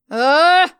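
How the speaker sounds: loud, furious ugh; angry roar. A narrator's reading. Emma roars after Stella in fury. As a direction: roar your frustration. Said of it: "I roared at her".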